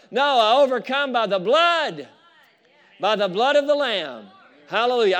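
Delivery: triumphant revival preaching; fiery preaching. Real, not acted. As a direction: preach it with full conviction. Preaching builds to full force.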